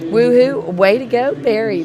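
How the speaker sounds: głośny radosny okrzyk; cheering. Real, not acted. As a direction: krzycz z radości. Cheering a winner.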